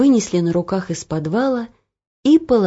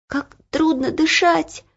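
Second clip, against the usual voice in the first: choking on smoke; 9 semitones higher than usual. A narrator's reading. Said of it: "закашлялась Ело".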